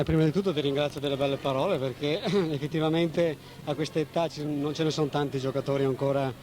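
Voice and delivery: ordinary delivery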